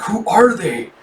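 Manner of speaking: high, incredulous question; startled question. Acted. Shady man asking about the strangers, startled question.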